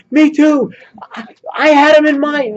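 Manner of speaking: high, loud imitation of eager adults chiming in; excited retold outburst, pitched well up; bursting to share. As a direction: jump in as if you can't hold it back. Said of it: "bursting at the seams talking about this".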